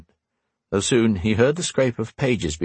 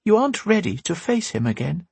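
lightly voiced older woman, higher; a hint of a wise old teacher; Windy's voice; 9 semitones higher than usual. A narrator's reading.

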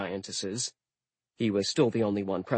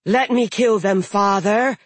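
high, loud growled threat; growling giantess; 10 semitones higher, 8 dB louder than usual. Acted. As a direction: snarl it like a bloodthirsty giantess. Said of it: "growled the daughter".

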